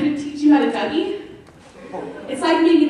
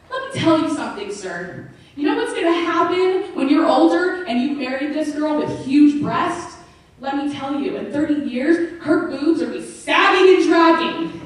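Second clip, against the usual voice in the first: imitating an indignant scolding, raised pitch; mock angry rant; 4 semitones higher, 5 dB louder than usual. Friend telling off a young man in the corner, hammed-up angry warning. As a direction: lecture him hard, building louder. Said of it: "Andrea doesn't have just an anger issue".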